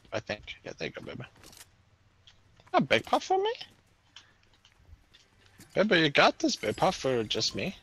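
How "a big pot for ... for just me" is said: high, gushing thank-you; mock delight. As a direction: gush over the gift like a present. Mock delight over a gift.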